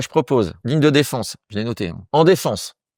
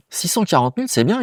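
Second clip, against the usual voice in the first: higher-pitched mock-impressed reply; impressionné, cité; 7 semitones higher than usual. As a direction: play the other guy, pleasantly surprised, pitch lifted. Autre youtubeur impressionné, cité. (imitation d'un collègue agréablement surpris)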